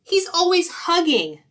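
high, loud griping; fed-up skunk whining about someone; annoyed skunk. Acted. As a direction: complain in exasperation. Skunk grumbles about the happy bear.

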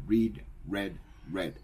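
"Read, read, read" said reed, red, red; first "read" as reed, then red twice; "read" forms said reed, red, red. Shown as a demonstration. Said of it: "Es como si fuera el color rojo".

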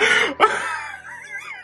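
high, crying laugh; real squeal of laughter; helpless laughter. Real, not acted. Viewer still overcome by the mistake, laughing.

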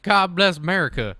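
put-on country accent. Playing the patriot for laughs, country accent. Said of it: "this here is a country accent".

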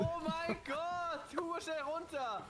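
panicking gamer. Real, not acted. Crash panic in game.

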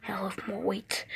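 whispered aside to yourself. Acted. Fighter whispering plans to himself before bed, hushed. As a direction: say it under your breath.

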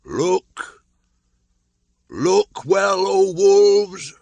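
high, loud ringing call; old call. A narrator's reading. Akela calling the Council together, high ringing shout. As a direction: summon the whole pack. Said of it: "called the old call".

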